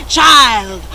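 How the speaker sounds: Grandfather Frost crying out. A narrator's reading. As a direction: call out slowly and grandly.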